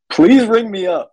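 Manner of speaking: high, laughing singer imitation; sing-song "Please ring me up" up high; bad acting. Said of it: "She delivers it like that too".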